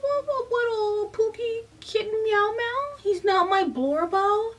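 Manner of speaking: high, whiny, slowed-down parody of Claire; mock whiny Claire. Reviewer mocking Claire's whining about Cam, in a high whiny mock voice. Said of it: "Claire whining about".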